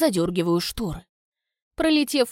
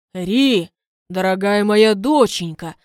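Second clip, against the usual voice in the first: gushing father; 7 dB louder, slower than usual. Acted. Duke's fake loving farewell.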